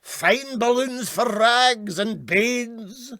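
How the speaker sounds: high, far-carrying Glasgow barrow call; high Glasgow hawker's call; street vendor cry. A narrator's reading. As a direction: cry your wares down the street. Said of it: "imitating some Glasgow street barrow vendor".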